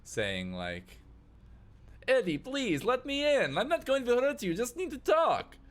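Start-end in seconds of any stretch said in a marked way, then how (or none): 2.1-5.6 very high, loud plea; pleading through a door. Acted. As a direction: shout persuasively to be let in.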